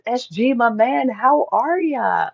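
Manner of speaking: mock chummy greeting. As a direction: play the cheery mate greeting a friend, drawn out. Reading a viewer's chat greeting aloud.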